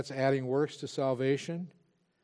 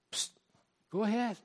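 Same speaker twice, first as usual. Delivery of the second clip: tempter coaxing; 7 semitones higher than usual. Retold or imitated. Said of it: "And the tempter comes like that".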